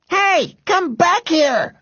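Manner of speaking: high, drawn-out shout. A narrator's reading. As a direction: shout after someone running off. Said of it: "shouted Robert".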